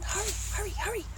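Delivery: high, soft, urgent repetition; whispered. Acted. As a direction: hurry someone along under your breath. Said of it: "whispered the pelican".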